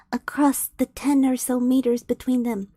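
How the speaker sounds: usual speaking voice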